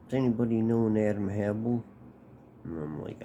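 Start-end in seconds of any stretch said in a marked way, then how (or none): 0.1-1.8 louder, a bit higher officer's call; police calling out. Retold or imitated. Chicago police asking the boys on the porch for Adam, calling out. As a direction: call the name out across the porch.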